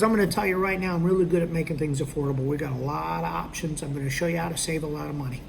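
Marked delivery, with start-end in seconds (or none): none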